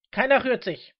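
high, loud police order; commanding shout. Acted. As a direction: order everyone to freeze. Armed agent breaks into the quarrel.

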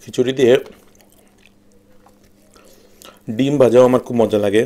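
talking while chewing; words muffled by food; mouth full. Real, not acted. Seen 1: Mouth keeps working on food between bites while he speaks.

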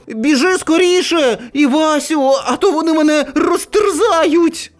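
put-on high, urgent boy's call; crying out. A narrator's reading. Narrator boy on the fence begs his friend, crying out. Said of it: "А я кричу".

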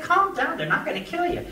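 very high-pitched acted protest; retold exasperated scolding. Cowhand to the panicking kid, exasperated scolding. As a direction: squeak it up high, incredulous at the kid.

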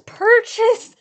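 high, loud burst; urgent exclamation. Real, not acted. Ticket buyer finally buying, urgent shout. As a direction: punch it out as the button is hit.

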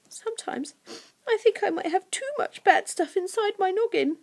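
put-on high, hesitant girl's voice; small, tearful confession in high pitch; mistaken girl's voice. A narrator's reading. Narrator reads the creature's confession, in girlish voice.

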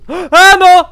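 high scared cry; startled shriek in the dark; panicking gamer. Real, not acted. Lights go out in horror.

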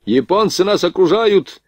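high, ringing command; urgent order. A narrator's reading. Lieutenant warns gunners they are surrounded, urgent shouted order. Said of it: "приказал подошедший пехотный поручик".

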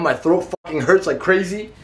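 ordinary delivery

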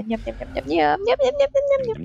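rapid yum-yum babble climbing high; playful munching noises, rising in pitch; nom-nom eating noise. Pretend. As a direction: gobble it up in nonsense nyam-nyams. Imitates greedy eating noises.

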